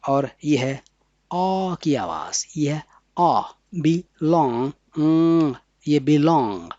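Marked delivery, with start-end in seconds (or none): 1.3-2.9 whispered "आ की आवाज़"; whispered aside. Shown as a demonstration. Teacher whispers which vowel sound it is. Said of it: "ये B".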